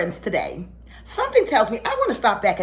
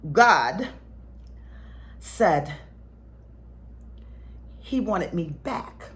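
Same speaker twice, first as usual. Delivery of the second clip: ordinary talk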